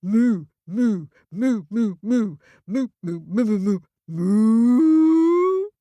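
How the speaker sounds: bursts of moos ending in one long, loud rising moo; cow mooing. Acted. Cow tells the ram to scram, in loud moos. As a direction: moo a whole sentence in cow language. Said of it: "in cow language sounds a bit like this".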